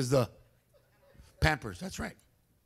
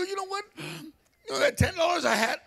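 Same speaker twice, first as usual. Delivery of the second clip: excited husband; 12 semitones higher than usual. Retold or imitated. Himself telling wife in story.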